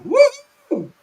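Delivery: high, loud cheering whoop; excited whoop. Real, not acted. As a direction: let out a happy woo. Cheering over the old record.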